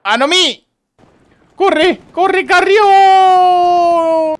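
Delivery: high, drawn-out yell urging him to run; frantic shout. Real, not acted. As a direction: scream it long and high like he's running for his life. Cheering a fleeing soldier on.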